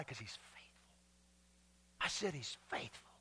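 hushed whisper of faith; whispered assurance to the congregation. Real, not acted. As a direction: drop to a whisper, intimate. Preacher drops to a whisper.

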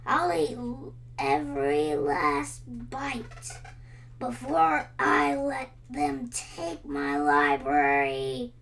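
loud growling dragon voice, slowing on every last bite; defiant dragon. A narrator's reading. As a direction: threaten like a cornered beast. Dragon devours library, defiant.

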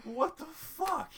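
slow, high-pitched outraged disbelief; incredulous squeal. Retold or imitated. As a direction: drag it out high and indignant. Reenacted disbelief.

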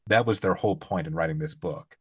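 ordinary talk, nothing marked

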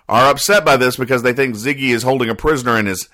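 ordinary talk, nothing marked

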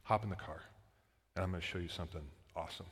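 very soft, low fatherly voice; confiding dad. Retold or imitated. Dad tells his kid to hop in the car, quiet confiding voice. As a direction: lightly act a dad letting his kid in on something, hushed. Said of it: "the dad just looks at the kid".

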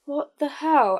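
stunned disbelief. Retold or imitated. Retelling her stunned reply.

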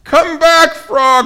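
high, loud desperate plea; shouting. A narrator's reading. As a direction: shout for a friend to return. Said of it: "he shouted".

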